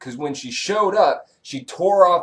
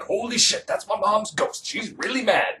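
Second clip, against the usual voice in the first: mock shock; 9 semitones higher than usual. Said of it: "He's like".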